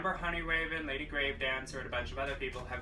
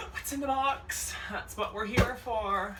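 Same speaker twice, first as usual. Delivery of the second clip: excited teasing; 9 semitones higher, 4 dB louder than usual. Real, not acted. Teasing the box contents.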